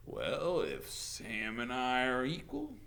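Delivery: slow, wheedling drawl; taunting sheriff. A narrator's reading. Sheriff angling for a kiss, taunting drawl. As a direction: tease her with her own argument.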